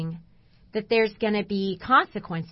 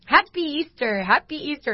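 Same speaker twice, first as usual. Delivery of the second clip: imitating higher-pitched, cheerful Easter greeters; cheery greeting; 5 semitones higher than usual. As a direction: chirp a holiday greeting, higher than usual. Churchgoers greet her cheerfully, retold.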